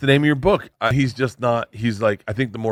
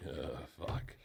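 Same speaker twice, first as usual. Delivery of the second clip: breathless unvoiced "Uh, fuck"; quiet stunned whisper; whispered curse; 8 semitones lower, 23 dB quieter than usual. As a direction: whisper it in dismay under your breath. Muttered reaction to daughter.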